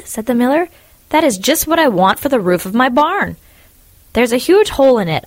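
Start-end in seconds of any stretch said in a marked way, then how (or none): 1.1-5.2 eager. Acted. Miller grabbing the wood.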